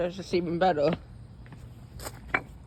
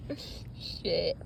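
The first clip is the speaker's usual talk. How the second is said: ordinary talk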